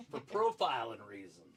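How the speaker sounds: laughing punchline. Real, not acted.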